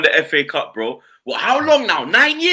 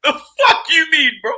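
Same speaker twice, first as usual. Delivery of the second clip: high, slow laughing outrage; incredulous laughing rant; laughing disbelief; 9 semitones higher, 3 dB louder, slower than usual. Retold or imitated. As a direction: cackle through the mock outrage. Laughing mock outrage at rival.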